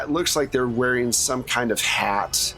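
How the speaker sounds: normal talking voice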